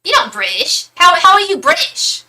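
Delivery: loud, high mocking British accent; indignant rough British accent, hammed up. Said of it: "She had a really rough accent".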